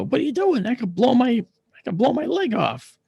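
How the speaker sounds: high-pitched, loud incredulous outburst; alarmed protest. Retold or imitated. Patient protesting about the gun in his lap, alarmed.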